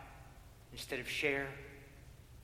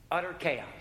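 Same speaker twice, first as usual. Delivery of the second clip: punched repeat; 3 semitones higher, 4 dB louder than usual. Acted. Preacher drives home the utter chaos to the congregation. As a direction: hit it hard the second time.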